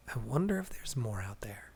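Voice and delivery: soft, menacing whisper. Acted. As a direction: let it out as a quiet threat. Standing over slain gods.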